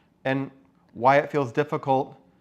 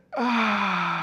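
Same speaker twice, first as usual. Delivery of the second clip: big exhale; 6 semitones higher than usual. Pretend. Letting out held breath.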